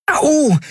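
high sudden ouch; sharp pain cry. A narrator's reading. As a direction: yelp in pain. Pain from pressure point.

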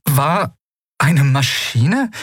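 can't quite believe it's a machine; a touch of stunned surprise; baffled. Acted. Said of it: "fragte sie verdutzt".

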